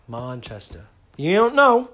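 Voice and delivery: put-on Manchester English accent; hammed-up Manchester accent; mock Manchester accent. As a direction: play a Mancunian explaining where he's from. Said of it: "people ask me all the time where I'm from".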